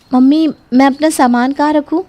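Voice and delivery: asking politely where to put things; shy daughter-in-law. Acted.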